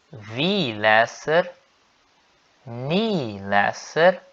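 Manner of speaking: slow, clear repeat-after-me conjugation; långsam övningsuppläsning; slow drill. Shown as a demonstration. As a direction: spell it out slowly for learners to follow. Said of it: "Sigam comigo".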